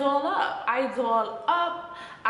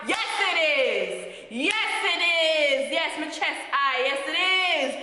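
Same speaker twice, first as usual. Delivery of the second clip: defiant retort; 4 semitones higher than usual. Retold or imitated. She answers her date's jab about her high standards, defiant. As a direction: throw the insult back proudly and emphatically.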